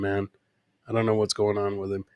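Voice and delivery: normal talking voice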